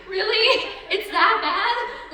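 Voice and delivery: a retold, hammed-up "really?"; mock disbelief. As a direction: play up being stunned the take was that bad. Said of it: "I'm like".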